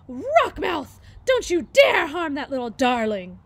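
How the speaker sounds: loud scolding goose voice; angry old goose. Acted. Old goose yells at the fish to protect the gosling, loud scolding.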